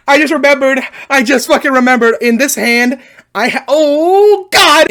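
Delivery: high, loud wailing; shouted mock distress; hammed-up anguish. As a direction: wail the memory out in agony. Relives the splinter pain.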